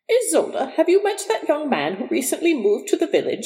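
high-pitched mother's voice; mother's inquiry. A narrator's reading. Mother asks her daughter over tea about the young man, high-pitched inquiry. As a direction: ask high and lilting, as a prying mother. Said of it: "she asked me one day".

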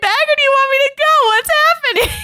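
very high, hammed-up protest; exasperated plea. Heroine confronting friends after coming back, high exasperated plea. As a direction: plead in high-pitched mock exasperation.

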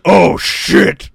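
loud imitated cry of dismay; mock alarm. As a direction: blurt it out loudly, playing the startled monster. Retelling Venom spotting Carnage in trailer.

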